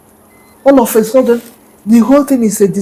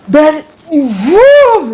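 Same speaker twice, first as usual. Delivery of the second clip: a raised, drawn-out buzzing vroom; imitated buzzing swarm; 5 semitones higher, 3 dB louder than usual. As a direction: make the swarm roar in. Flies arrive with a buzz.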